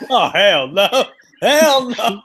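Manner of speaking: high, loud "hell no"; loud disbelief. Real, not acted. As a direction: shout it in amused disbelief. Reacting to the silly accent.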